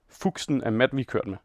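usual speaking voice

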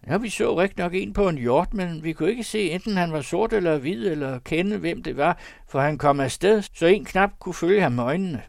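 high, loud, eager farmer voice; the answering farmer. Acted. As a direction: report it loudly, pitched well up. (ivrig bonde med høj stemme)

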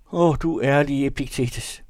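high, drawn-out, loud sigh of lament. A narrator's reading. Narrator sighs to Epiktetes, high drawn-out lament. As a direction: lament it slowly and high, as if sighing. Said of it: "sukkede jeg".

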